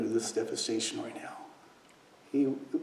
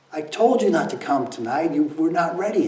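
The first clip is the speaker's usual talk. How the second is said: louder, exasperated parental scolding; imitated scolding; 5 semitones higher, 8 dB louder than usual. Father's inner voice scolding his stepson, loud exasperated reproach. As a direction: scold him like a fed-up father.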